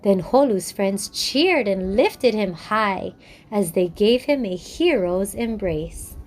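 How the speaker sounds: gentle, measured storyteller close; story narration. A narrator's reading.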